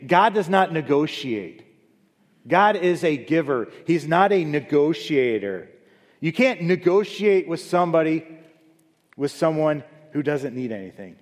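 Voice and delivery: loud, high pulpit declaration; preacher proclaiming God to congregation, loud; forceful preaching. Acted. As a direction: hammer each line home. Preacher declares God's nature.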